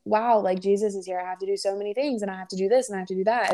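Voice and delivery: stressed, rattling off the chores; Martha. Retold or imitated. Martha lists all she has to do, hurried and stressed. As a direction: imitate someone rushing through a to-do list. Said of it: "Martha was like".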